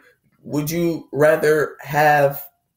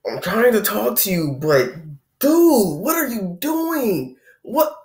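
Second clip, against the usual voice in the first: put-on high, strained plea to a toddler; talking to a kid who won't listen, pitched up; dude, what are you doing, rising in disbelief; exasperated parent; 7 semitones higher, slower than usual.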